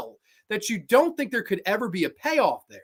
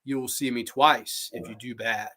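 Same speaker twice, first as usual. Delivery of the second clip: low, slow, measured warning; cowboy's line; 5 semitones lower than usual. Retold or imitated. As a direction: lay it down slowly like an ominous film cowboy. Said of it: "all the stuff he says to him".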